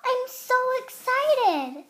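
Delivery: high, loud and drawn out with glee; a little girl squealing her joy, slowly; excited. Acted. Noah squeals over the baby news, excited. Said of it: "said Noah with excitement".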